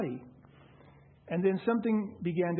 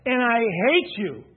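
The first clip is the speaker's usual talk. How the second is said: loud, hammered-out declaration of hate; retold menacing shout, drawn out on the repeat; imitated shouting; 3 semitones higher, 8 dB louder, slower than usual. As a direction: shout it in his face, punching the key words. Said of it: "he's shouting this in my face".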